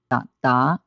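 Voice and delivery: first DA low, second DA sweeping upward; low, then rising. Shown as a demonstration. Teacher shows third plus second tone, DA low then DA rising. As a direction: dip on the first DA, lift the second. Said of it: "Third tone plus second tone".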